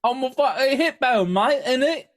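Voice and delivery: hammed-up British accent, pitched high; mock British accent. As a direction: tack on innit like a Londoner. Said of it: "I switched into British".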